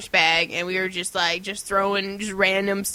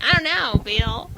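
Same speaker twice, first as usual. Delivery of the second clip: higher-pitched clueless put-on reply; mock dim heroine; 5 semitones higher, slower than usual. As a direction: answer like a hammed-up clueless girlfriend. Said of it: "And you're like".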